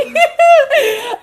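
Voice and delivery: loud, very high cackle; real high, loud laugh; burst of laughter. Real, not acted. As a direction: shriek with laughter. Laughing at friend's anxiety comment.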